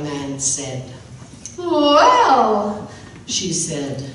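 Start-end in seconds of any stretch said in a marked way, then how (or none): 1.6-2.9 higher, nervous bride; a shy young wife's answer; lightly voiced new bride; bride's voice.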